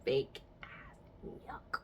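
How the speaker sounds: unvoiced scornful aside; whispered scorn. Real, not acted. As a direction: breathe it under your breath. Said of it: "aside about ex".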